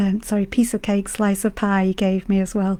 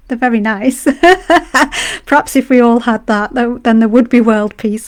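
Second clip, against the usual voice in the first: real laughter spilling into louder speech; laughing; 2 semitones higher, 8 dB louder than usual. Real, not acted. Streamer tickled by cake bringing world peace, laughing. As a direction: laugh while making the point.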